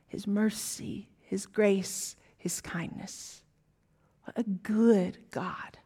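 a whispered, slow "What a good God"; hushed whisper. Real, not acted. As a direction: breathe the words in awe. Tender close to the point.

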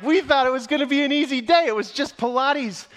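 high, loud, whining complaint; worn-out recruits whining to the instructor; high whiny protest. Retold or imitated. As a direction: whine like beaten grown men.

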